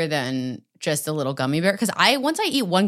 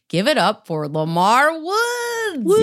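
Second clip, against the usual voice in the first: hype announcer; 6 semitones higher, slower than usual. Real, not acted. Introducing the guest, hyping.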